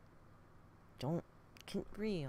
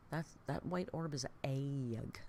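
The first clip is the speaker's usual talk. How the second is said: normal talking voice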